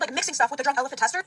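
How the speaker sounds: usual speaking voice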